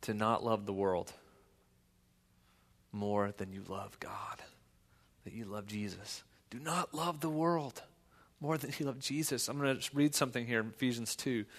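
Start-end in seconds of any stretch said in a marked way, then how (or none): 0.0-9.5 softer, near whisper, leaning in; hushed earnest plea. Real, not acted. Pastor pleads quietly with the church, near whisper. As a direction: drop to an intimate hush.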